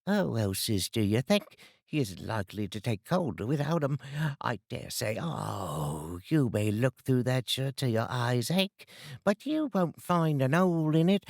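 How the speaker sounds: a coarse London charwoman, pleased with herself. Acted. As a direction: cackle it out like a cheeky charwoman. Charwoman voiced in story.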